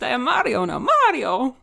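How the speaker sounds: Mario impression. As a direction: ham up the Italian plumber, lightly. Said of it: "my accent has changed".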